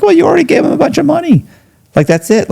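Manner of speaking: retold incredulous pep talk; exasperated reassurance. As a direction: push back fast, voice jumping up. Arguing with the worried inmate.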